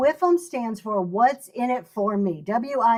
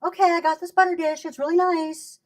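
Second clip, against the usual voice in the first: mock flat, higher-pitched brush-off; bored seller; 6 semitones higher than usual. Seller contrasting a lazy pitch, mock bored. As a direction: toss it off with no enthusiasm.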